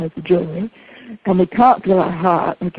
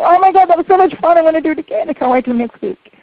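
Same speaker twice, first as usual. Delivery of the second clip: much higher and louder, bubbling with delight; excited gush; 9 semitones higher, 3 dB louder than usual. Retold or imitated. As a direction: burst out thrilled to mum after the call. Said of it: "I go".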